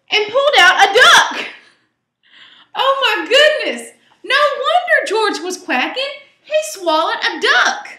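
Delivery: high, loud gasp of surprise; amazed reveal. A narrator's reading. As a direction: reveal it like a shock. Second reveal, excited.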